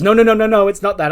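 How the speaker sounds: flustered denial. Acted. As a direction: protest quickly, flustered. Candice hastily denying to Johnny.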